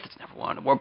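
whispered aside; quiet aside. Real, not acted. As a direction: drop to a sly whisper. Self-deprecating aside after boast.

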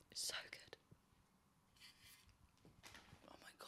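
whispered tenderly into the phone; breathy whisper, nearly no voice. Real, not acted. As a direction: whisper loving words to a friend. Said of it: "voice note".

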